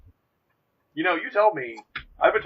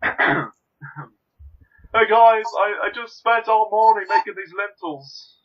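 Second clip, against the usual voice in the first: much higher, louder whiny British hippie voice; Neil from The Young Ones; 7 semitones higher, 7 dB louder than usual.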